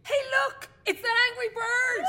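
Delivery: high, excited call across the room; excited shout. Real, not acted. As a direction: point her out and shout. Woman spots her in prison.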